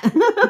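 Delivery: bursts into a high embarrassed giggle. Real, not acted. She hides under her hat, giggling.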